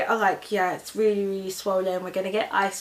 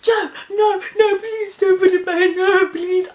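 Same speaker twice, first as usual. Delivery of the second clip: high, loud, wailing begging; acted shrieking plea; pleading yell; 10 semitones higher, 8 dB louder than usual. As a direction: beg frantically, almost crying. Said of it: "I'm yelling at her".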